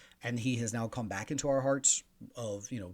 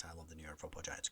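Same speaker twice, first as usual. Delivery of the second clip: fast, softer whispered confession; 7 semitones lower, 14 dB quieter, faster than usual. Acted. Host admits his Giants bias, whispering fast. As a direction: slip the admission in as a quick whisper. Biased fan confession aside.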